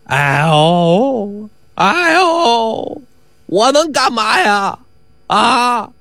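drawn-out, whining complaint; milk the pain, stretching the words; aggrieved rogue putting on agony; groaning injured faker.

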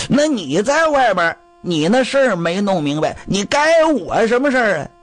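high-pitched, defiant retort; indignant talking back. Acted. Old wife answers her scolding husband back, indignant. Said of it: "老太太不服气呀".

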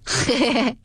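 替众人学出一阵短促的嘿嘿笑; 众人打趣时的嘿嘿笑; 笑；轻声嘿嘿一串笑. A narrator's reading. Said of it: "都笑道".

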